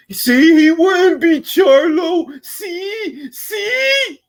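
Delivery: high, slow whiny fan voice; mocking Charlo fans. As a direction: mimic a smug boxing fan, drawn out. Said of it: "the Charlo fans come out from under a rock".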